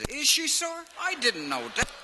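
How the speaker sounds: put-on high, loud country-boy voice; naive George. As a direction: ask it wide-eyed and high. George answers the officer.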